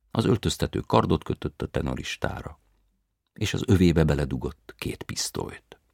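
ordinary delivery, nothing marked

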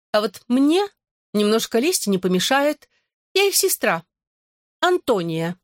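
high, piping little gargoyle; thin fairy voice. A narrator's reading. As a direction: squeak it like a tiny fairy. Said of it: "пронзительный и тоненький, как у феи".

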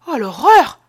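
exclamation horrifiée, aiguë et forte; s'écrier, saisie d'horreur; cri d'horreur. Acted. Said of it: "s'écria Marceline". (voix qui monte et éclate d'effroi)

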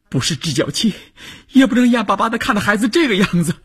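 high, fast outburst; agitated protest. Acted. Wife refuses to just watch her child suffer, high, rushed protest. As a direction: protest in a rush, voice climbing sharply.

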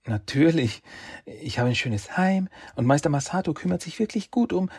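put-on young woman's voice. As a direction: voice her kindly but puzzled. Shen voiced, interrupted.

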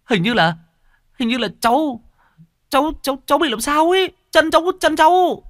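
put-on terrified young man pleading about his feet; panicked. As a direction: stammer out the fear, pitch rising. Pallbearer says something grips his feet.

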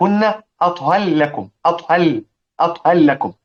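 أطهر لكم with the ر folded into a doubled لّ, no r heard; merged r into l. Shown as a demonstration. Teacher showing students أطهر لكم with ر merged into لام. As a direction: say أطهر لكم as atha llakum. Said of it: "فين الراء؟ لا وجود لها".

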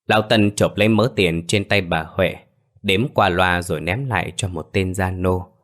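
ordinary delivery, nothing marked

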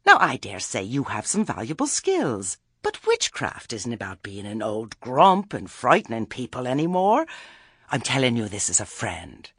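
patronizing talk, rising in pitch mid-way; condescending Lettice. Acted. Lettice telling Granny off as a friend, condescending. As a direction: lecture her as if doing a favour.

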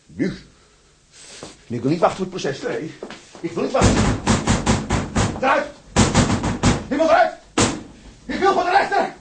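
screaming high and loud to be let out; frantic shouting. Acted. Prisoner in his cell demanding to see the judge, frantic shouting. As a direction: hysterical yell at the cell door.

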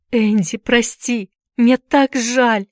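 high, slow, tearful apology; pained apology on the edge of crying; through tears. A narrator's reading. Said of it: "сквозь слёзы проговорила Дженни".

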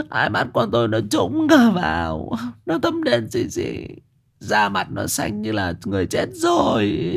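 high, quavering, breaking into sobs; tearful wailing. Acted. Wife sobbing to her husband about their dead daughter's ghost. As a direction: wail it out like a mother losing her child. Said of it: "bà ôm mặt khóc tu tu".

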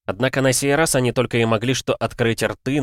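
usual speaking voice

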